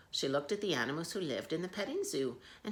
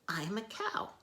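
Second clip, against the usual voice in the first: high cow voice; 6 semitones higher than usual. Acted. Miss Moo answers.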